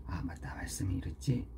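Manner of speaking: low, hushed inner thought; quiet self-reminder. Retold or imitated. Father reminding himself of scripture while angry, quiet self-talk. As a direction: murmur it softly, as if remembering.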